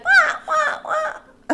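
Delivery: high, loud imitation newborn wail; mock baby cry. Begging co-host to play along, mock baby wail. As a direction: squawk like a pretend newborn. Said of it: "I just want you to be like".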